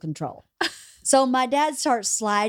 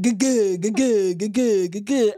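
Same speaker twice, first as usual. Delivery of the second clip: rhythmic repeated "guh-gooh" sounds; evenly pulsed, rounded grunting syllables; slow-motion wobble noise. Retold or imitated. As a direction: imitate a body jiggling in slow motion. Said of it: "I remember it in slow motion".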